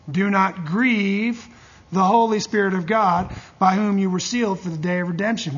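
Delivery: scripture reading. Acted. Reads scripture aloud.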